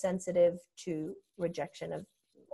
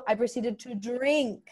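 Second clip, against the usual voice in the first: normal talking voice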